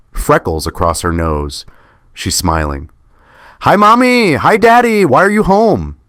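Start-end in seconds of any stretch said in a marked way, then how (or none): 3.6-6.0 put-on much higher, louder child voice; cheerful little girl. Little daughter greeting her parents at the door, high and cheerful. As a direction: bounce in like a happy six-year-old.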